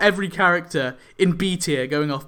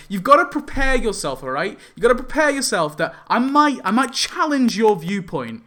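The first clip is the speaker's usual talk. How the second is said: louder, higher heated lecture; heated warning; 6 semitones higher, 4 dB louder than usual. Real, not acted. Vlogger warning commenters about his tier lists, heated. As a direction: warn them, fed up.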